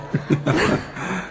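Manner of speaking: a soft little laugh trailing off; quiet laugh. Real, not acted. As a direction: laugh gently at your own joke. Laughs after her answer. (धीमी सी हँसी)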